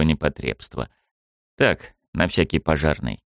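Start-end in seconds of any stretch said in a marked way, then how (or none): none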